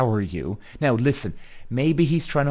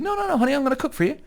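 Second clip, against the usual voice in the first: much higher, hammed-up sweet reassurance; sugary boyfriend; much faster than usual. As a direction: coo it up high like a doting boyfriend. Boyfriend voiced soothing girlfriend.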